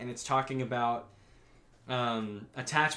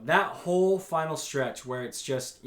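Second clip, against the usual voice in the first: ordinary talk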